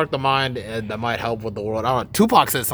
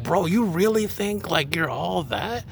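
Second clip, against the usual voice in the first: slow, higher-pitched scornful question; mock incredulous; 4 semitones higher, 3 dB quieter, slower than usual. Retelling a challenge to a conceited Aquarius, mock incredulous. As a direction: drag out the challenge in disbelief.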